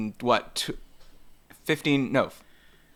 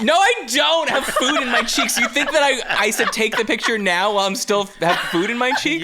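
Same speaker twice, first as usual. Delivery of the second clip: laughing protest; 8 semitones higher than usual. Real, not acted. Protesting the chewing accusation, laughing.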